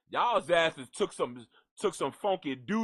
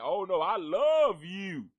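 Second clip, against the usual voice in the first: fake sweetness; 3 semitones higher, slower than usual. Lying to someone he can't stand, in put-on fake sweetness. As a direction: lay on the phony affection. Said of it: "lie to myself and be like".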